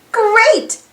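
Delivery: ordinary delivery, nothing marked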